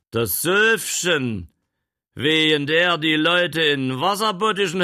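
teasing gentleman. Acted. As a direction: savour the name Wetterhexe.

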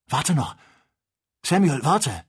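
höher und lauter rufen; dringender Zuruf. A narrator's reading. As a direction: ruf ihn eindringlich zurück. Calls urgently to Samuel. (dringend rufend)

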